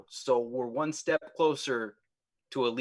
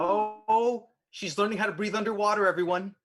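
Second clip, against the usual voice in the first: excited; 6 semitones higher than usual. Real, not acted. Excited player announcing news.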